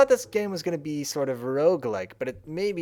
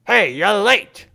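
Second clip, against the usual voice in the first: loud character greeting; 5 semitones higher, 8 dB louder, much slower than usual. Pretend. Voicing game character greeting.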